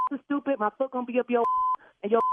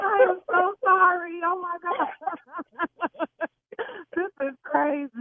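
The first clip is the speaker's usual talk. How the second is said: laughing; 8 semitones higher than usual. Real, not acted. Laughing after the prank reveal.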